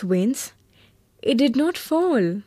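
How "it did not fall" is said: ordinary delivery, nothing marked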